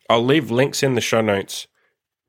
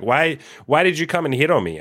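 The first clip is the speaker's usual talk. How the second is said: imitating a girl; 7 semitones higher than usual. Girl's question acted in story.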